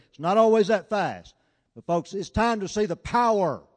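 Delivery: urgent pulpit call to action; full-force preaching. Acted. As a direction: drive it home like a revival preacher.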